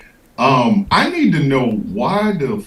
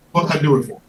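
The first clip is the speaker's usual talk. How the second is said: usual speaking voice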